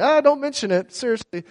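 high, light imitation of a doctor brushing it off; breezy doctor. ER doctor waving off the hiker's thanks, lightly voiced. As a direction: toss it off like it's nothing.

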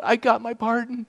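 high, choked gasp of relief. Retold or imitated. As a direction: gasp it out through overwhelming emotion. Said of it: "finally I just gasped it out".

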